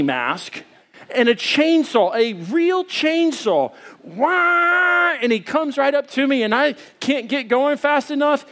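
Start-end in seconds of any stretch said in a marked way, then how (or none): 4.0-5.1 startled yelp. Retold or imitated. Chainsaw man jumps out.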